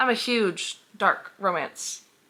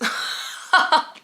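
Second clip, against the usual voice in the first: breathy laugh; 9 semitones higher, 3 dB louder than usual. Real, not acted. Laughs at own joke.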